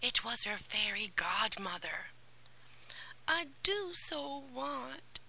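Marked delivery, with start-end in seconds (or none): 3.3-5.2 slow, tearful plea. Acted. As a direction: speak through held-back sobs. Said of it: "Then her sobs stopped her".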